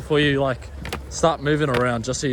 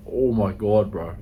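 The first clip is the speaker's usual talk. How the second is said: usual speaking voice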